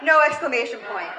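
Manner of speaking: ordinary talk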